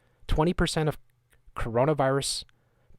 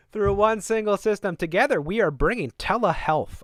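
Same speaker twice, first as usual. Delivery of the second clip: mock ad read; 8 semitones higher, 5 dB louder than usual. Reading the ad aloud.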